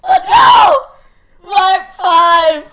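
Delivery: very high, loud victory scream easing down; overjoyed screaming. Real, not acted. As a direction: scream in triumph, overwhelmed. Player celebrates beating the night.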